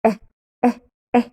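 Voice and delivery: E as three separate, very short "é" sounds; closed "é". Shown as a demonstration. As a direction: clip each E into a closed "é", pausing between. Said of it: "on ferme la bouche". (E répété en « é » bref et fermé)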